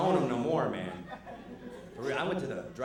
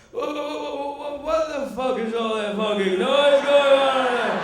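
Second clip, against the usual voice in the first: much higher, much louder furious yell; angry waking shout; 12 semitones higher, 14 dB louder, slower than usual. Retold or imitated. The dad waking up yelling at the noise, very loud higher shout. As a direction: roar it from bed. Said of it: "That's me waking up".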